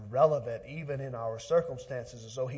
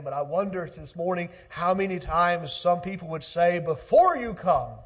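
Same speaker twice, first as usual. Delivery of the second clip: raised, louder preaching voice; 6 semitones higher, 11 dB louder than usual. Acted. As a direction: preach it out to the congregation. Preaching at full force.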